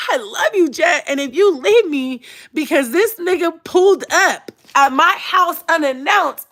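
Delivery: high, drawn-out hammed-up begging; desperate mock pleading. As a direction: whine it like a melodramatic lover begging. Acting out her desperate plea to Jet.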